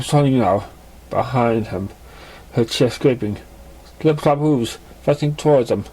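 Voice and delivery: normal talking voice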